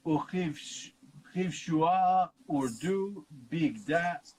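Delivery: slow, deliberate Hebrew chant; Hebrew recitation. Acted. Teacher recites the Hebrew verse for listeners, slow intoning.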